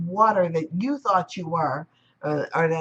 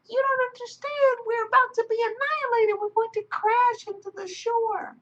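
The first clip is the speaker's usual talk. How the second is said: high, hurried dread; panicking wave. Acted. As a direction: plead that doom is coming. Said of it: "He was like".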